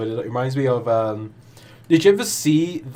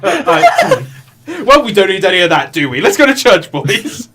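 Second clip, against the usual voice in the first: Ned Flanders voice; 6 semitones higher, 6 dB louder than usual. Retold or imitated. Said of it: "Ned's like".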